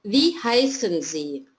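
ordinary talk

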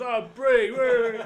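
high, very loud, gleeful brie; mock drunk delight. Drunk bloke finding the brie, high and loud delight. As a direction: cheer for the cheese like a drunk bloke.

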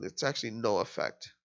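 ordinary delivery

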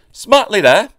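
puffing orders. A narrator's reading. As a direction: bark it like a proud little engine. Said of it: "puffed Oliver".

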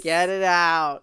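slow, drawn-out command; slow dramatic demand. Pretend. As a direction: drag out each word. Hates ice.

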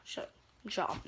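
hushed to herself, barely voiced; whispered shut up. Real, not acted. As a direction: mutter it under your breath. Muttered to herself.

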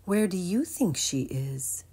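ordinary delivery

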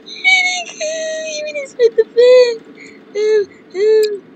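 very high, loud, stretched squeal; sing-song it way up high; silly high voice. Pretend.

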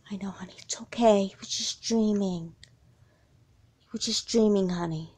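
soft, slowed whisper of reassurance; whispered comfort. Acted. Mom soothing Heidi after the bad dream, soft whisper. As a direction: hold her close and hush her. Mom calming frightened daughter.

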